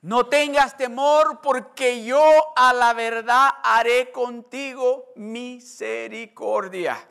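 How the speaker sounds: loud preacher proclaiming the promise; preaching at full force. Acted. (predicación fervorosa y fuerte)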